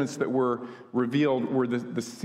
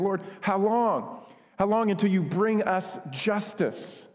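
slow, higher, drawn-out cry of "how long"; pleading martyrs; 4 semitones higher, slower than usual. Acted. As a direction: plead slowly like the suffering martyrs. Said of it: "and they're saying".

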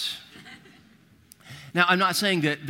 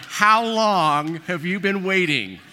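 slow, louder, drawn-out mock accusation; slow teasing accusation, a bit louder; mock accusing; 2 semitones higher, 5 dB louder, slower than usual. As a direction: drag it out like you caught him. Preacher retorting to dad.

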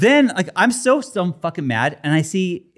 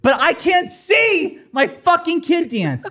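frustrated; 9 semitones higher than usual. Retold or imitated. Retold frustration, shouted.

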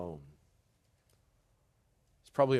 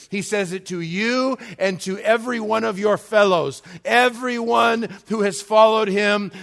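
loud, high, driving sermon delivery; raised pulpit voice, emphatic and insistent; impassioned preaching; 8 semitones higher, 13 dB louder than usual. Real, not acted. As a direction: preach it at full force, urgently pressing every phrase home. Preaches at full force.

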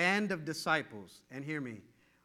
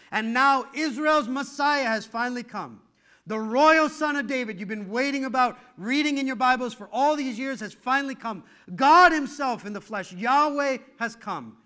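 loud, higher, building sermon cry; rising preaching shout; 6 semitones higher, 9 dB louder than usual. Real, not acted. Preacher crying out about the Messiah, loud and high.

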